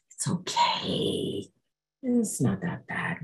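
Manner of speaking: hushed, slow coaxing whisper; inner tempting voice. Retold or imitated. The dark past murmuring on the bus, a coaxing whisper. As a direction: soothe yourself into giving up. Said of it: "This is what was going on in my head".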